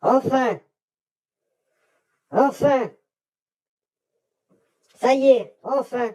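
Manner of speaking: high excited cry of relief; real excitement, pitched high. Real, not acted. Orb finally appears.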